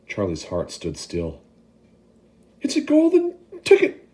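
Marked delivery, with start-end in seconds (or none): 2.6-4.0 a much higher, louder excited scream. Acted. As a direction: shriek the news. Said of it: "screamed the shopkeeper".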